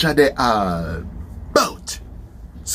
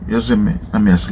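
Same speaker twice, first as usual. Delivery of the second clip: usual speaking voice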